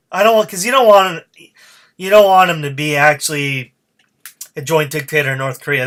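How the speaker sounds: usual speaking voice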